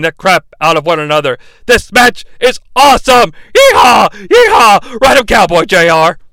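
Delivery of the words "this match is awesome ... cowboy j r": loud yeehaw shout; mock shouting. Imitating Shane whooping at J R. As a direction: holler it like a rodeo fan. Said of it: "I just tell you what he says".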